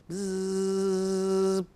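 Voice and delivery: long, steady voiced buzz; imitated buzz. Shown as a demonstration. Teacher buzzing round the board in a letter game, steady buzz. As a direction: buzz like a bee on one note.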